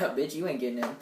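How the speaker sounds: low, soft mock tough talk; low defiant muttering. Teen retelling how they faced the door, low and defiant. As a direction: keep it under the breath, low and cocky.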